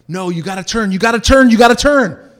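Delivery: urgent friends shouting him into the turn; building panic, turn turn turn; panicked yelling. Retold or imitated.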